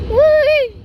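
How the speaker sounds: loud happy whoop; excited whoop. Real, not acted. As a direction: whoop with pure delight. Cheering inside the car wash.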